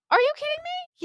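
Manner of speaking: high-pitched incredulous outburst. Retold or imitated. Podcaster recalling her reaction to women flagging Lisa while arguing, high incredulous. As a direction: squeal it in exaggerated disbelief. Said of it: "I'm like".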